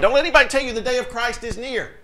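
ordinary talk, nothing marked

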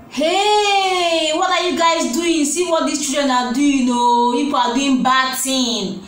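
long high "hey", then easing down; alarmed calling out. Retold or imitated. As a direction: imitate a witness calling out on catching children. Acts out witness catching the children.

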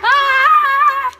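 loud mock yell; play yell. Charging through the skeleton, loud play yell.

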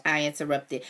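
usual speaking voice

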